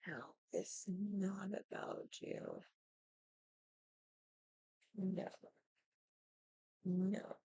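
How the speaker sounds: very soft, slow no; barely voiced, firm refusal; hushed rebuke. Real, not acted. Telling him off under her breath, very soft.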